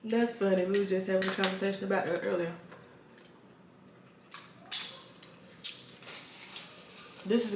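talking with food in mouth; chewing while talking. Real, not acted. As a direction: mouth partly full. Seen 0: Mouth moving around food, eyes half-closed; next mussel lifted at 1.9.

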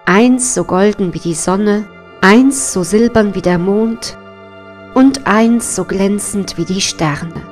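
plain reading voice

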